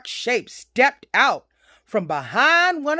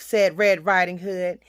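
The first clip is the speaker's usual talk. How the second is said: plain reading voice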